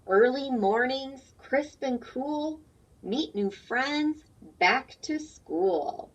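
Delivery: slow, sing-song rhyming read-aloud; reading to children. A narrator's reading. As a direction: read the rhyme slowly for little ones. Reads picture book aloud.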